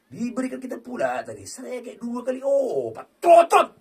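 high, loud happy yelling; excited shout. Real, not acted. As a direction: cheer the win. Celebrating win. (teriakan gembira)

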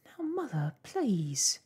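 put-on high, imploring young girl; begging. A narrator's reading. Daughter tries calming mother, begging. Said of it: "begged Arrietty".

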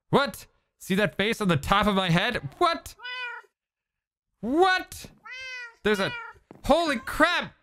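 squeaky high, stunned disbelief; a high, disbelieving yelp; shocked. Real, not acted. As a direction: pitch shooting up in shock. Shocked by twin face.

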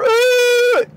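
high whiny "ooh"; drawn-out mocking moan; mock whining. Storyteller mocking quitters who whine, high mock whine. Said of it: "Quit that whining".

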